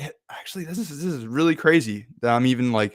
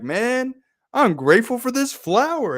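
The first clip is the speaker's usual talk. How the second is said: much higher, gushing thanks; playful delight; 12 semitones higher, 4 dB louder than usual. Acted. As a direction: gush playfully over a small wonder. Acting out playful gratitude for flower.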